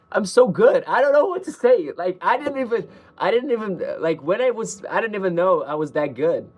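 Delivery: high-pitched, fast, gushing amazement; bursting with self-praise, pitch jumping up; amazed at oneself. Real, not acted. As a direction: gush in stunned delight at your own work. Amazed by own lyrics.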